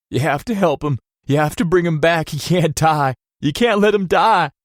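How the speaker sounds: loud, high begging; desperate pleading shout. A narrator's reading. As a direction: beg them to save him. Son begging staff.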